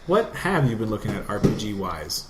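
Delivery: normal talking voice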